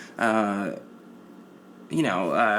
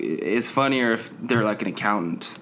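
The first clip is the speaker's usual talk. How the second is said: ordinary talk, nothing marked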